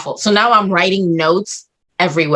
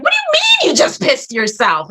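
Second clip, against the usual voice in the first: higher-pitched disbelieving question; imitated incredulity; 10 semitones higher than usual. Man questioning her about wetting herself, imitated incredulity. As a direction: mimic someone aghast at the news. Husband's shocked reaction acted out.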